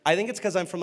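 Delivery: usual speaking voice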